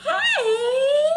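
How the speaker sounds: very high, loud squawk; pigeon with new hope. Acted. Pigeon spots another big something coming, high excited squawk. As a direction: squeal it with sudden interest. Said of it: "the pigeon goes".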